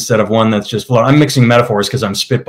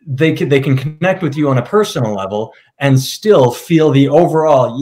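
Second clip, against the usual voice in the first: normal talking voice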